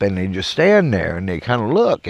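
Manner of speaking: ordinary talk, nothing marked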